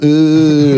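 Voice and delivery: rising mock boo; crowd booing. Crowd boos his arrest, loud mock jeer. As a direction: hoot it loud like a jeering crowd. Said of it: "They were all booing it".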